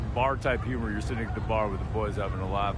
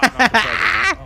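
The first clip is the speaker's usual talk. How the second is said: shouted high punchline; loud, high joking shout; 12 semitones higher, 12 dB louder, slower than usual. Real, not acted. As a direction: blurt the joke out loud. Punchline to a stranger.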